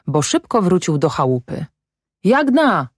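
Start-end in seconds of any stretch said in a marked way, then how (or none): 2.2-2.9 high-pitched call to his wife. A narrator's reading. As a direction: call her name across the house. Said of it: "zawołał".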